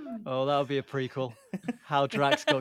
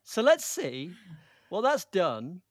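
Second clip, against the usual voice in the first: exaggerated retake; 4 semitones higher, much slower than usual. Shown as a demonstration. Host trying the leaderboard line over, exaggerated announcement. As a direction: announce it big, pausing after "see". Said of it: "let me try again".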